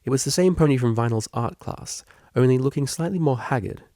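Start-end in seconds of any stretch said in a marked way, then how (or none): none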